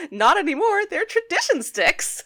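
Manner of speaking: put-on high, loud kid's retort; imitated line delivery. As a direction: fire back high and loud, drawing out the end. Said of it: "And her delivery on this line".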